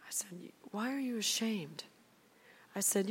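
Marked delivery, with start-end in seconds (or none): 0.7-1.8 gentle question. Retold or imitated. Gently answering ashamed friend.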